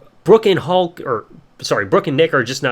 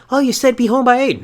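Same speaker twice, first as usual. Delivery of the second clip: put-on higher, louder innocent girl voice; lightly voiced teen daughter; 9 semitones higher, 5 dB louder than usual. Daughter answers her angry dad, higher innocent voice. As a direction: play the teen daughter excusing herself. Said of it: "Brooke's like".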